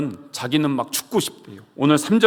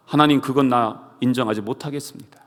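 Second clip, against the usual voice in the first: ordinary delivery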